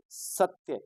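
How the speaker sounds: सत्य said slowly, with स pressed hard and त्य light; stress on स. Shown as a demonstration. Teacher showing students the weight on स in सत्य, slowly stressed. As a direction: lean on the स of सत्य, slow and firm. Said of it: "स को जोर लगा के बोलना".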